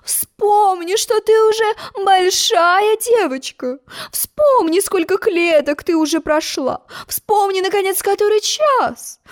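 high, slow soothing queen; a hint of a fussy queen comforting; lightly voiced coaxing queen. A narrator's reading. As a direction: coax the child gently out of tears.

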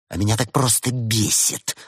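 ordinary delivery

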